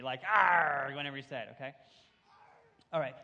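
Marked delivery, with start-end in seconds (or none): 0.2-0.9 loud pirate "arrr"; high-energy pirate roar. Shown as a demonstration. Teacher urging kids to keep up the energy, loud pirate roar. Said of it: "try to have that much energy".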